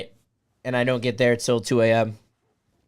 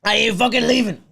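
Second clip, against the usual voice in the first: higher-pitched, defiant movie-quote shout-out; Jordan Belfort; 7 semitones higher than usual. Acted. Streamer quoting a film to his viewers, defiant impression. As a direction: belt out a stubborn refusal to go. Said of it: "Jordan Belfort".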